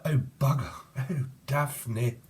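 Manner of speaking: lightly hammed British exclamation; a hint of mock British; British accent. Channeling a British person, mock British accent. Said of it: "There's a British person up in here".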